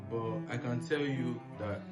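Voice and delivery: normal talking voice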